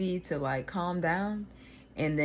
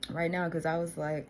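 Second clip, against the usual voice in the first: ordinary talk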